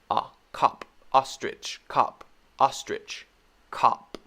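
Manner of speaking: "Ostrich" and "cop" with an open "ah"; short O demo. Shown as a demonstration. Teacher drilling short O words, careful open "ah" in "cop". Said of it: "when you say this sound".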